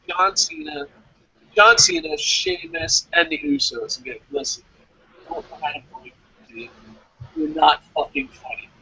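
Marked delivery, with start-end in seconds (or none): none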